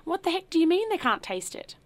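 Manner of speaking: high, loud indignant demand; outraged. A narrator's reading. Host demanding the butler explain himself, outraged and high. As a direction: fire it back in disbelief.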